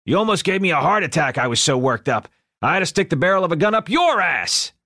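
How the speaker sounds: boisterous gangster rant; loud gangster rant. A narrator's reading. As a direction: blast it out like a hotheaded mob boss. Ed explains his rage.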